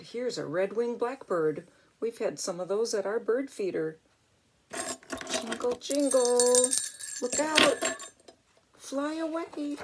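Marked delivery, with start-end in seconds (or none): 5.3-9.8 high, slow warning chant; bell's warning. Acted. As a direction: ring a gentle alarm. Bell warning birds.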